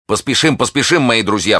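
rally friends in alarm; urgent cry. A narrator's reading. D'Artagnan hurrying his friends, urgent cry. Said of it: "вскричал Д'Артаньян".

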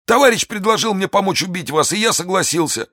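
high, strained voice of a wounded bandit; frightened bandit. Acted. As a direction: plead in a thin high voice.

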